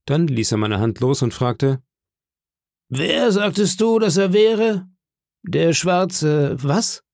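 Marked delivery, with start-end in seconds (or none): 2.9-7.0 high questioning voice, slowing at the end; puzzled landlord. Acted. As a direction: query a name as if unfamiliar. Silver questions the boy in feigned puzzlement.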